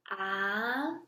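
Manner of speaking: long rising "ah"; second tone. Shown as a demonstration. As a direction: start "ah" low and sweep it upward. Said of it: "The second tone is a rising tone".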